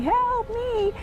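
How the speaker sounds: very high mock squeak; squeaky cry. Son retelling the fly scene with a very high squeak. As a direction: imitate the tiny fly's squeak. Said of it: "they're looking and going".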